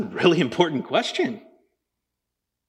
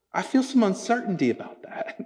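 normal talking voice

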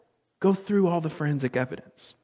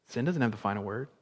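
low, soft and quick statement; hushed assurance; 4 semitones lower, 7 dB quieter, faster than usual. Real, not acted. Preacher reassuring the congregation after "Praise God", hushed. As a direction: say it softly, like a settled truth. Preacher quietly restates, then proclaims it.

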